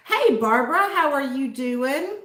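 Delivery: ordinary talk, nothing marked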